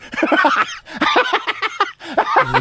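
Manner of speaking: high-pitched cackle; helpless high giggling; real laughing fit. Real, not acted. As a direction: crack up in a long, high laugh. Cracks up telling the trip.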